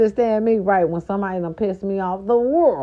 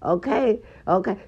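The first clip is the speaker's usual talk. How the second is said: ordinary talk, nothing marked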